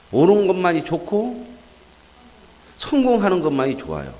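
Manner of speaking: high, loud, impassioned sermon; fervent preaching. Acted. Pastor to the congregation, preaching high and loud. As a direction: preach it with fire, rising to a peak.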